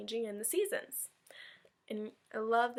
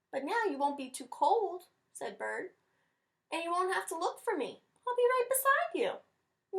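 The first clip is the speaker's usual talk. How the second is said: higher put-on bird voice, rising higher on the last line; sweet bird voice; 7 semitones higher than usual. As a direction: comfort a friend like a little bird. Bird comforts Rabbit.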